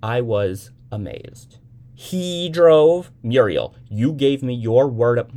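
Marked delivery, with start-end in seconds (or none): 1.9-5.3 much louder, higher, appalled; shocked mother. A narrator's reading. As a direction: gasp it out in horror.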